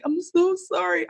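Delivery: high, slow whimpering apology; mock tearful sorry. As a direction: imitate a crying intern, lightly. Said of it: "she was like".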